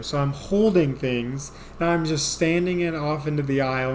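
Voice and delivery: normal talking voice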